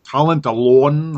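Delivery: put-on slow, lightly done Minnesota accent; drawn-out Minnesota accent, slightly hammy; Kurt Russell Minnesota accent. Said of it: "Kurt Russell in the movie Miracle".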